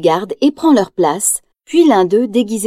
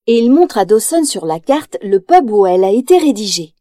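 plain reading voice

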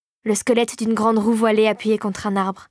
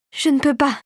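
higher, strained protest; distressed; 4 semitones higher than usual. A narrator's reading. Clara dangles over the abyss, mildly distressed and higher. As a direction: plead a little higher, in mild distress. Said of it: "Ses pieds pendaient au-dessus d'un gouffre".

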